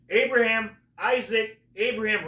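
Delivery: loud, high calling of names. Retold or imitated. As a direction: call out frantically for them.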